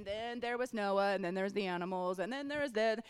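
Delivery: mindless run-on recital, mock reading aloud; going through the motions; rote reading. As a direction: rattle it off like reading without taking it in. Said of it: "you're not just reading".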